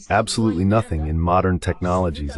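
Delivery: ordinary talk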